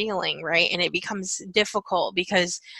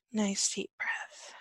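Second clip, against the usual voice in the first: slow, hushed breathing cue; very soft, slow guidance; soothing whisper; 14 dB quieter, slower than usual. Real, not acted. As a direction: whisper them gently into a breath. Guiding a calming breath.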